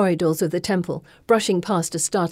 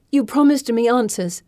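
indignant; 5 semitones higher, 5 dB louder than usual. Acted. Demanding answers from Circe.

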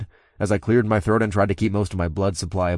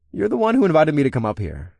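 higher-pitched playful tease; teasing; 8 semitones higher than usual. A narrator's reading. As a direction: tease her with a lifted pitch. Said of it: "said with a teasing wag".

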